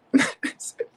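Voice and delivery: shrill mocking laugh burst; high laugh. Real, not acted. Laughs at opponent.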